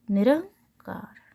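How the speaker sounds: निरंकार said slowly, dictating as written; slow spelling-out. Shown as a demonstration. Teacher dictating the word while writing it slowly. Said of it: "ऐसे हो जाएगा".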